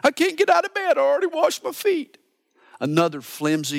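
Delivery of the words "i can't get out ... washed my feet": much higher mock-whiny wife, quick at the end; a pouty bride who won't get up; whiny wife excuses. Preacher mocks the wife's lazy excuse, high and whiny. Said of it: "she's got a clean foot fetish".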